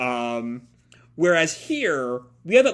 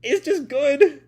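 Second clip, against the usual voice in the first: gushing; 10 semitones higher, slower than usual. Real, not acted. Gushing about the voice.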